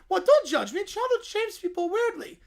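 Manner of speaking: high squeaky defensive Perry. Acted. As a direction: pitch way up for the little animal.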